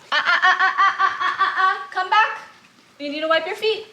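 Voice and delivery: high, loud pet-owner voice; correcting the dog. Real, not acted. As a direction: stop the dog with high, quick warning calls. Stops dog coming in muddy.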